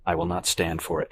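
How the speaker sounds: plain reading voice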